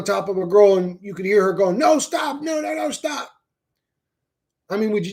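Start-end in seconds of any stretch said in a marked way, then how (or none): 1.8-3.3 shrill mock pleas for help, voice pitched way up; imitated pleading cries. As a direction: cry out like a girl being attacked. Voicing a victim's cries.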